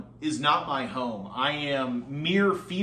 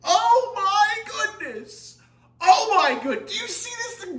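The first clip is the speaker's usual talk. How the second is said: shrieking jackpot winner; 4 dB louder than usual. Real, not acted. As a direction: squeal with giddy delight. Shrieking delight at the board.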